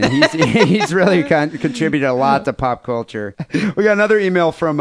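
normal talking voice

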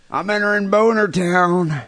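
slow, gruff trucker impression; Trucker Paul voice. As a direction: drawl it like a trucker. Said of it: "the sound of Trucker Paul's voice".

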